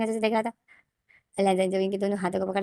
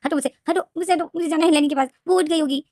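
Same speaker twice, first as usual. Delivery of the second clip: much higher, louder frantic shouting; desperate shout; 8 semitones higher, 4 dB louder than usual. Acted. Wing shouts at Lenjan to let him go to Leni, high and loud. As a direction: shout to get free.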